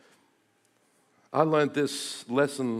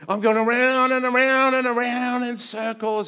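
frantic; 8 semitones higher, 6 dB louder than usual. Retold or imitated. Frantic retelling of being lost.

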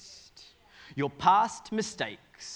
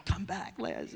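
strained call-back, a little higher; mock pleading; 3 semitones higher, 6 dB quieter, slower than usual. Speaker calls after the word that left his mouth, mock pleading. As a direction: try to reel the word back in.

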